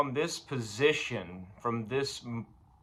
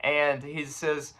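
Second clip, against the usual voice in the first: ordinary talk, nothing marked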